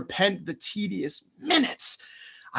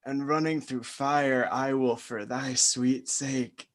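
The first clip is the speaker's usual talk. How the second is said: ordinary delivery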